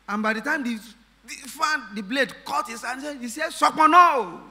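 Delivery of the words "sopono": sudden loud, high outcry; retold shout of shock; imitated startled shout. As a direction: blurt it out at full volume, as if just cut. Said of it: "So he just shouted".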